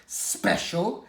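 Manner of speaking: rising scoffing echo; a touch of spluttering scorn; mild splutter. Acted. She-cat scoffs at his special claim, mild splutter. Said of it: "she spluttered".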